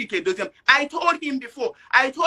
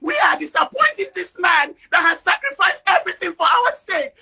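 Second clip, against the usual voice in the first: louder, raw upset outburst; real angry shouting; 3 semitones higher, 4 dB louder than usual. Real, not acted. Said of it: "you sound very angry".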